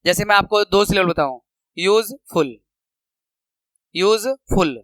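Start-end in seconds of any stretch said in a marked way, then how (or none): 1.8-2.6 useful split as यूज, pause, फुल; broken into syllables. Shown as a demonstration. Teacher showing two syllables, useful broken in the middle. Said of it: "जो टूट के बोलता है". 3.9-4.8 useful in two separate beats, यूज and फुल; broken into syllables. Shown as a demonstration. Teacher repeating a two-syllable example, useful split apart. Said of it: "जो टूट के बोलता है".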